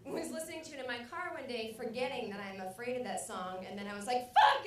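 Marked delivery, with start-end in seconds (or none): 4.3-4.7 sudden loud, high curse; explosive re-enacted scream of alarm; startled yell. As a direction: yelp it out in shock. Acts startled yell while driving.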